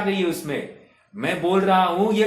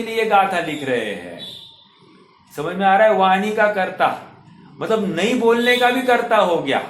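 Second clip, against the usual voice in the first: louder, driving sermon lines; forceful preaching; 4 semitones higher, 3 dB louder than usual. Acted. Preacher urging his audience, forceful preaching. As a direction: press the belief home to the listeners.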